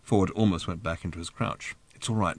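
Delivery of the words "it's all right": muttered much softer, aside. A narrator's reading. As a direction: mutter it under your breath so Thrashbarg can't hear. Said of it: "muttered Arthur".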